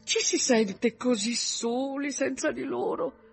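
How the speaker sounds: high, plaintive mother voice; lamenting mother. Acted. Mother lonely without her daughter, high-pitched lament.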